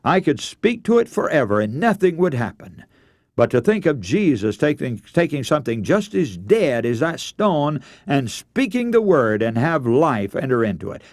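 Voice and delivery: ordinary delivery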